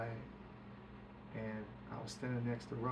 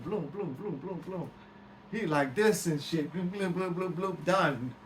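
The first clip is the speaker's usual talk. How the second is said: bloom sound effects; 4 semitones higher, 5 dB louder, slower than usual. Retold or imitated. Imitates Murphy's entourage moving.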